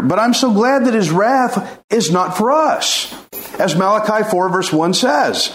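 usual speaking voice

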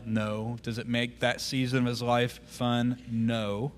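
ordinary delivery

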